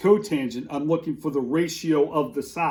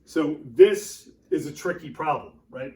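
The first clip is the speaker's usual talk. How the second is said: usual speaking voice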